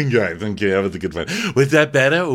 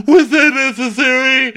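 much higher and louder; loud indignant repeat; 9 dB louder than usual. Retold or imitated. Dorinda demanding of those left at the table, loud indignant. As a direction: blow up on the repeat. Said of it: "Dorinda's like".

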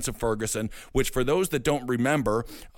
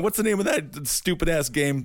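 ordinary delivery

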